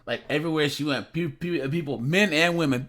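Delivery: ordinary talk, nothing marked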